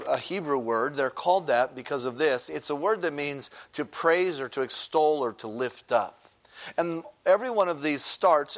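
ordinary delivery

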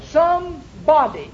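"some body" split in two, weight on body; slow down and lean on "body" in "some body"; stress on BODY. Real, not acted. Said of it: "emphasis on the body".

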